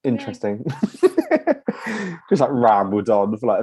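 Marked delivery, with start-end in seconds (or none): 0.7-2.2 high giggle. Real, not acted. Laughing at own rambling.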